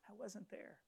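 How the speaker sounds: hushed self-reproach; quiet regret. Retold or imitated. Father thinks to himself while digging, quiet regret.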